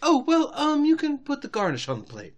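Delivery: high-pitched, halting Rarity; hesitant big sister. Acted. Rarity finding her sister a small job, high hesitant voice.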